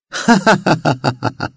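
high, loud mocking cackle; mocking demonic laughter. A narrator's reading. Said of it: "صوت ضحكات استهزاء قوية". (ضحكة شيطانية ساخرة)

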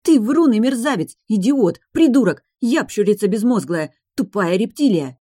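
high, loud string of insults; angry yelling. A narrator's reading. Enina berating Velizar, angry yelling. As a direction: hurl each insult like a blow. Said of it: "Он слушал её вопли".

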